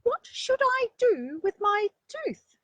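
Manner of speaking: high, loud little bunny voice; a small child bursting to show off her tooth; excited little rabbit. Acted. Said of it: "she asked".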